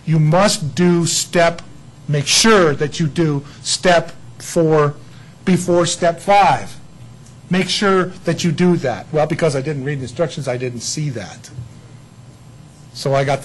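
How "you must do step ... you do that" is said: very slow, loud and deliberate warning; slow manual warning. Retold or imitated. Preacher reading the manual's warning, slow and loud. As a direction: spell out each step like a stern manual. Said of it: "it says".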